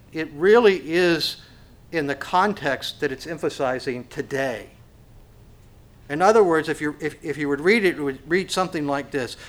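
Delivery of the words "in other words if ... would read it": ordinary delivery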